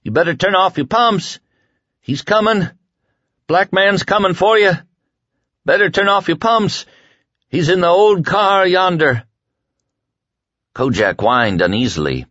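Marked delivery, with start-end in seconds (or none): 0.0-9.2 high, loud delirious warning; shouting in sleep. Acted. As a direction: yell the warning from a fever dream. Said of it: "yelling in his sleep".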